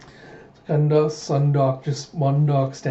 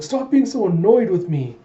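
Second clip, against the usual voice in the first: higher, mildly indignant princess; pouty protest; 6 semitones higher, 4 dB louder than usual. Acted. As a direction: complain as if wounded by coldness. Princess objects to his coldness.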